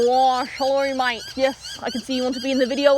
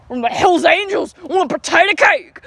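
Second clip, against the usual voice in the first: gruff boast, higher and louder; name-dropping tough guy; 6 semitones higher, 5 dB louder than usual. Retold or imitated. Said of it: "And he's like".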